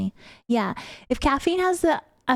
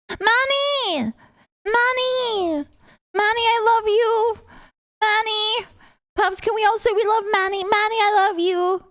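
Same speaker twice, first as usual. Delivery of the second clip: calling out excitedly; 8 semitones higher than usual. Real, not acted. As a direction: shout the name high across the room. Calling out to a viewer.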